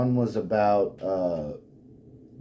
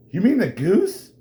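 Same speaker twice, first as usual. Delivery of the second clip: higher, louder mock question; mock realization; 7 semitones higher, 5 dB louder than usual. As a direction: act it out with a laugh. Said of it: "all she sees is this".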